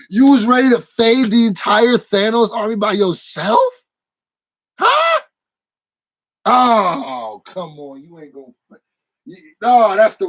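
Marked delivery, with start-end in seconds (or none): 4.8-5.2 mock disbelief. Real, not acted.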